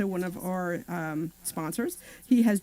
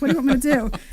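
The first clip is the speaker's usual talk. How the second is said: louder, higher exasperated comeback; retold exasperation; 5 semitones higher, 9 dB louder, much faster than usual. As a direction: throw it back like a fed-up wife, retold. Retold phone quarrel with husband.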